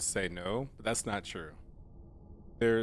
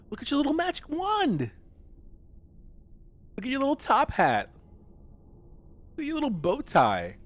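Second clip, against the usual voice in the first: gushing; 13 semitones higher than usual. Real, not acted. Gushing over a magician cat's little outfit, gushing. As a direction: squeal over something adorably tiny.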